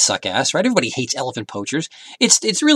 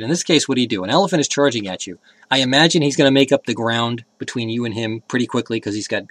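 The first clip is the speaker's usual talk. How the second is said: normal talking voice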